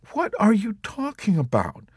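high, trembling plea; plead, voice wavering; quavering plea. A narrator's reading. Nurse Kramer pleading with Yossarian, quavering. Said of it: "pleaded with him in a quavering voice".

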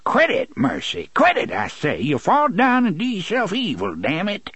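high-pitched, exasperated rustic scolding; cursing scolding. A narrator's reading. Si catches struggling Mercy by the arm, cursing scolding. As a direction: scold her sharply in a rustic accent. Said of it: "He cursed and caught her arm".

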